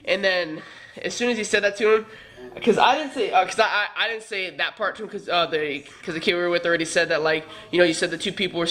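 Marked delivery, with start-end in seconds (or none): none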